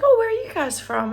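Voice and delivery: high, loud retold greeting; eager small talk. Hotel worker asking Australian guests, imitated eager small talk. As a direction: act out a bright-eyed opening question.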